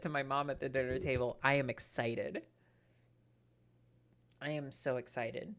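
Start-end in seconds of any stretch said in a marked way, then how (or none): 1.4-5.5 sarcastic. Real, not acted. Deadpan fake enthusiasm.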